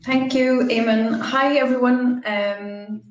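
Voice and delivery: ordinary delivery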